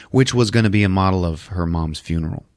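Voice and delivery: normal talking voice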